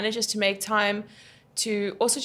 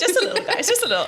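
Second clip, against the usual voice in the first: laughing; 10 semitones higher, much faster than usual. Real, not acted. Playful laughing modesty.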